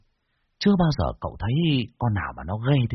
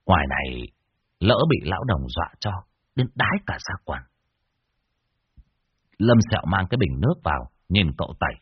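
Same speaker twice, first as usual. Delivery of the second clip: ordinary delivery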